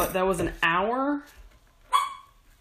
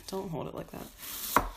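whispered self-scold; 3 semitones higher, 7 dB quieter than usual. Real, not acted. Scolding herself while cutting.

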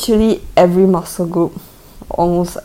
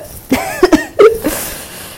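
high pained laugh; laughing through pain; 3 dB louder than usual. Real, not acted. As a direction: laugh as the massage hurts. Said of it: "when you're laughing through the pain".

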